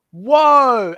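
a loud realization; hammed-up wow; mock surprise. Retold reaction to webpage.

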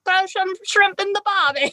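a laughing, badly faked Australian accent; mock Aussie line, cracking up; hammed-up Australian accent, half laughing. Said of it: "I'm terrible at accents".

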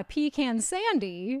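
pecan sandy said PEE-can sandy; switched pronunciation. Shown as a demonstration. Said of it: "Though I do have to say".

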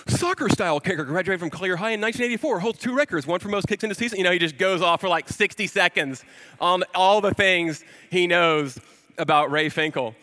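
rapid, breathless list; all in one breath. Retold or imitated. Ace reciting Finkle's history to the dad: rapid breathless list. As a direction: rattle it off without stopping. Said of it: "it's all in one breath".

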